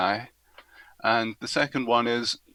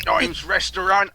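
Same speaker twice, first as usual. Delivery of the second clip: higher, badly faked American accent; attempted American accent; 6 semitones higher, 3 dB louder than usual. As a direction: have a shaky go at sounding American. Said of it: "I can't do the American accent".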